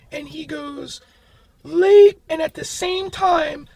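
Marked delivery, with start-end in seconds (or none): 1.6-2.2 sudden loud. Retold or imitated. Friend answering on phone.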